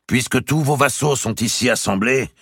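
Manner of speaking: high, loud, solemn appeal; Robert's plea. A narrator's reading. Robert appealing to the king before the peers, high and loud. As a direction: raise the voice to the whole court.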